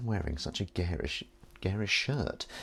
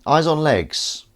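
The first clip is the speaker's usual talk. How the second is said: loud, slow, higher call; drawn-out greeting; 9 semitones higher, 9 dB louder, much slower than usual. Acted. Hailing the viewer eyes on legs, loud and drawn out.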